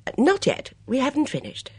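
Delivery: put-on high, lively voice of a fussy older lady; a bright, gossipy mother at breakfast; chatty Mrs Swettenham. Mrs Swettenham answers Finch.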